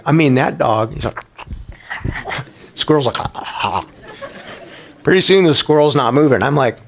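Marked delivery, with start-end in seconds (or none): 3.2-3.8 much lower, softer imitated squeaking; squirrel squeaks. Pastor voicing the squirrel in his dog's jaws, soft squeaks. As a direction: mimic a caught squirrel's feeble squeaks.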